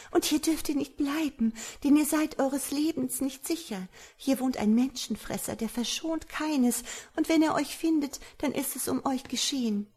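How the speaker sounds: high-pitched woman's voice, alarmed; young woman, warning. A narrator's reading. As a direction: warn the stranger urgently, voice held high. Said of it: "sprach sie". (hohe Frauenstimme, besorgt warnend)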